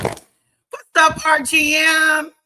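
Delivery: high, loud shout-out to a fan; loud greeting. Real, not acted. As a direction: call out to the fan with energy.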